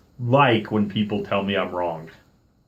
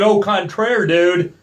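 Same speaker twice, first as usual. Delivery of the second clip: high, loud mock-fancy contradiction; sassy retort; 10 semitones higher, 6 dB louder, slower than usual. Contradicting the park worker, sassy high retort. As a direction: throw it back with sass.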